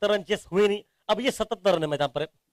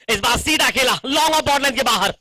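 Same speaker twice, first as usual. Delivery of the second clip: excited cricket commentary; 4 semitones higher, 6 dB louder than usual. Real, not acted. Boundary hit, commentator calls it.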